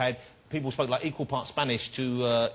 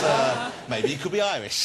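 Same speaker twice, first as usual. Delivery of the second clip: ordinary delivery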